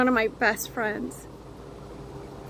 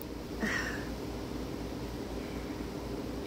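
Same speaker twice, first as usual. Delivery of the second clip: tearful sigh; 14 dB quieter than usual. Real, not acted. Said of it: "I'm really trying not to cry".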